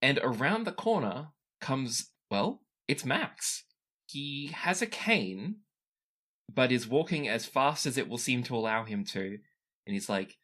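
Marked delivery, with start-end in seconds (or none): none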